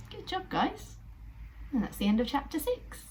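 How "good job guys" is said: cheery praise. Acted. Praising the characters.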